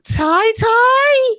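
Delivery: put-on squeaky, slow baby talk; babyish high mock. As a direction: stretch it out, very high and teasing. Mocking babyish word.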